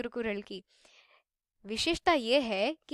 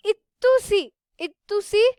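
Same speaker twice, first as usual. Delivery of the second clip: इतुसी said higher, like a tiny thing; cute tiny word; 6 semitones higher, 6 dB louder, slower than usual. Shown as a demonstration. Teacher to students, pitching up to show how tiny roots are. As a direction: make इतुसी sound small and cute.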